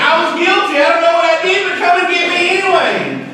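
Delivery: put-on high, pleading little girl voice; child voice. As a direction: voice a small child calling to be fetched. Child voice in a story.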